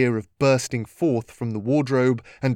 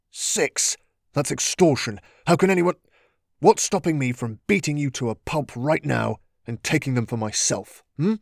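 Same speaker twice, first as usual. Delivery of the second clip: outraged; 3 semitones higher than usual. Acted. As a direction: explode at the swindler. Gennaro outraged.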